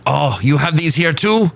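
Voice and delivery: a poor stab at a surprised German visitor; awful German accent. Retold or imitated. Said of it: "That was an awful German accent".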